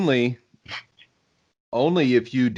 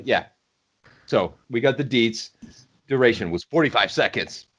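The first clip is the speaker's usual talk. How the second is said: ordinary delivery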